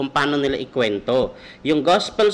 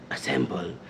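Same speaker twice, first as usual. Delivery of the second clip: muttered command; 9 semitones lower, 9 dB quieter, slower than usual. Retold or imitated. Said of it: "Sabi niya".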